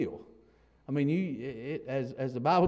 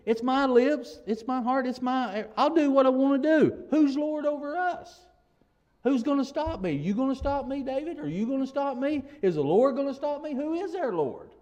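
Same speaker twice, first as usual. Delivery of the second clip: higher-pitched mock boasting; proud defiance; 7 semitones higher, 3 dB louder than usual. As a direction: challenge them like the arrogant wicked, voice raised in pitch. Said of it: "they're proud".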